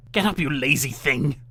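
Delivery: put-on bully the girl awake; loud harsh witch scolding. A narrator's reading. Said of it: "the witch said".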